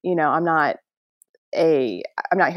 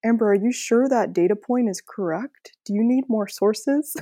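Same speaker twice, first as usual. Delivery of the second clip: higher, put-on doubting academic; Doc's voice; 5 semitones higher than usual. Imposter questions her talk preparation, put-on character voice. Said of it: "he talks like this".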